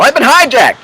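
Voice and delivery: high, loud, laughing shout of the words; playful yell. Shown as a demonstration. Coach showing listeners how to yell it, loud and high. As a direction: yell it out to break the spell. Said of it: "just yell".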